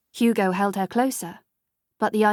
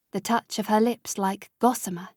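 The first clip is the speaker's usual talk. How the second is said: plain reading voice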